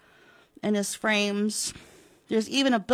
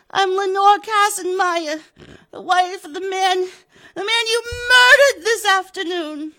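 high, loud, breaking into tears; crying widow; 10 semitones higher, 8 dB louder than usual. Acted. Widow telling Pratt he killed her husband, tearful. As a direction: sob out the accusation.

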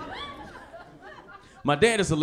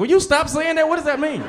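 exasperated; 10 semitones higher, 5 dB louder than usual. Retold or imitated. Son exasperated at dad.

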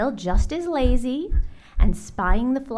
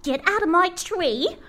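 indignant bird, climbing higher; outraged bird ordering someone off; sputtering; 5 semitones higher than usual. Acted. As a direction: scold the thief, pitch rising to the end. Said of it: "she sputtered".

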